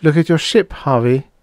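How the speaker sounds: high woman's voice, resigned then probing; Dana resigned. Acted. Dana beginning her story to Harvey, resigned. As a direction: give in and begin to explain.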